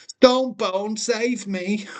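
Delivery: high, pleading save me; damsel plea. Pretend. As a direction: beg to be rescued, hammed-up. Pleading to viewer for help.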